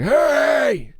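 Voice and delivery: high, strained mock yell; imitated far-off dugout scream; imitated screaming dad. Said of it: "my dad screaming from the dugout".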